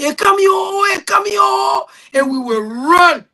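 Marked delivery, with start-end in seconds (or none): 0.0-1.9 shouted warning, voice up high; yelling. Retold or imitated. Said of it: "you will hear people yelling".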